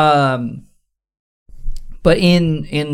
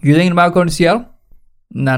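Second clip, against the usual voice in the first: ordinary delivery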